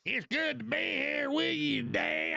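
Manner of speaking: Hillary Clinton. Acted. Host mocking politician visiting Kentucky, exaggerated hillbilly accent. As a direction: holler it like a mock Kentucky granny. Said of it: "Hillary when she goes to Kentucky".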